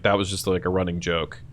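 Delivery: ordinary talk, nothing marked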